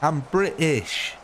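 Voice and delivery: "British" exaggerated, split in two slow beats; broad British pronunciation. Shown as a demonstration. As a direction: stretch "British" out in broad dialect. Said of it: "that's my Britishness coming through".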